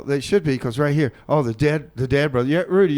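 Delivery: ordinary delivery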